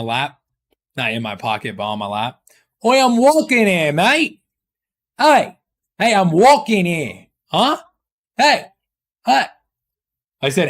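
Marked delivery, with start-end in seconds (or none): 2.8-4.4 higher-pitched mock British accent. Switching accents for chat, in a mock British accent. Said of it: "I can't switch those accents". 5.2-9.5 hammed-up New York accent. Switching accents for chat, in a mock New York accent. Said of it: "I can't switch those accents".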